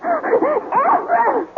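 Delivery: high, panicked cry of his name; desperate plea. Acted. Wife pleads with jealous husband, panicked high cry. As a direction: beg him to stop, voice rising.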